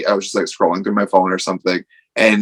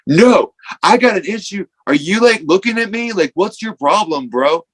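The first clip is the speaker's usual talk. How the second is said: confrontational; 9 semitones higher than usual. Retold or imitated. Kid yells at the stranger by the gas station wall, aggressively. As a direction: puff up and pick a fight.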